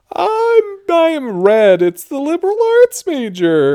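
high, loud thrilled squeal; excited shriek. Real, not acted. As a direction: squeal with delight. Shrieking excitedly at quiz result.

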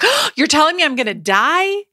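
affronted high-pitched disbelief; shocked and upset. Retold or imitated. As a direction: retell the moment he told you that you'd die. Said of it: "And I was so upset".

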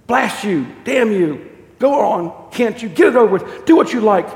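loud, high exasperated bellow; acted angry, desperate outburst; bellowing ghost. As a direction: bellow it out in torment. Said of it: "bellowed the ghost".

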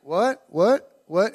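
puzzled, rising questions just out of sleep; imitating a confused sleeper; groggy waking. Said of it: "really groggy".

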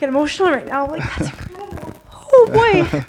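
choked up with emotion; crying. Real, not acted. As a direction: voice breaking into tears, high. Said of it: "I'm still crying like a baby".

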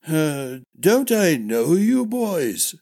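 put-on high, slow, wavering old man's question; puzzled old sword master, drawn out; old master-at-arms, puzzled. Old master squinting at his former pupils, puzzled.

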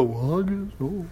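very soft, slow mock acceptance; meek resignation. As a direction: trail along meekly, barely voiced. Mimics meekly following the leader.

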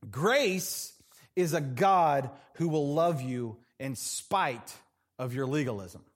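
ordinary delivery

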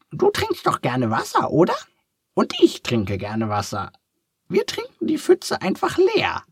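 very high, squeaky little duck, curious; a cheerful duck asking kindly. A narrator's reading. Duck thinks, finds idea.

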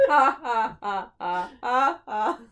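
hammy villain cackle; villain laugh. Retold or imitated. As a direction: cackle like a cheap animatronic.

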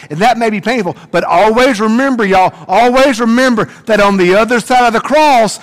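high, loud impassioned sermon climax; preaching at full force. Real, not acted. Pastor to his congregation, full-force preaching. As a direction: lift the church to the promise of the empty grave.

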